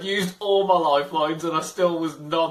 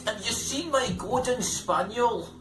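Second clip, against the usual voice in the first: put-on light Scottish accent; quick put-on Scottish lilt; 6 semitones higher than usual. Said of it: "in a Scottish accent".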